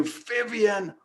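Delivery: high, slow stunned question; stunned caller asks the doctor what she did; amazed disbelief. Retold or imitated. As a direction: ask in open-mouthed disbelief. Retold amazed call to doctor.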